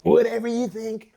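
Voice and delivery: laughing through a defiant brush-off; smug voice. Pretend. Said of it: "they're like".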